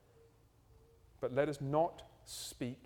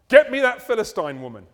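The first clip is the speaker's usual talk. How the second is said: demanding Samson; 6 semitones higher, 17 dB louder than usual. Retold or imitated.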